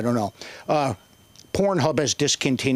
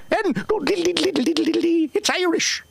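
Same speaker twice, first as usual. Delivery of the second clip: high, hammed-up diddle-diddle jig voice; sing-song it like a silly jig; mock Irish jig. Mock jig sounds, stereotype.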